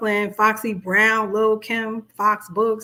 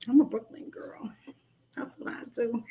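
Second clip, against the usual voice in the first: whisper; 8 dB quieter than usual. Real, not acted. Proud aside about Brooklyn.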